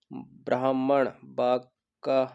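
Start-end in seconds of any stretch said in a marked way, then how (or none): 0.1-1.1 ब्राह्मण drawn out as "braa-ham-man"; careful correct form. Shown as a demonstration. Teacher modelling the right spelling, careful "braa-ham-man". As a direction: sound out the h in ब्राह्मण. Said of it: "पहला वाला सही है".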